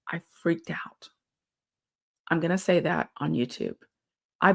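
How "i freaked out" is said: whispered, slow and soft; hushed confession. Real, not acted. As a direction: drop to a breathy whisper as you admit it. Confessing a tick panic.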